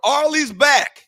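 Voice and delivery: loud, high excited shout; bright call-out of good news. Real, not acted. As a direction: hype up the return like an announcer. Announcing returning reporter.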